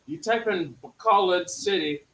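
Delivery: heated. Real, not acted. Heated lecture about pronunciation.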